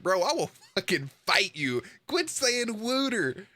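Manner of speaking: comic fed-up protest; mock outrage. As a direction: rant at him, slowing as pitch climbs. Acted rant at the video dude.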